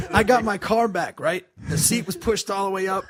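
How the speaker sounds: usual speaking voice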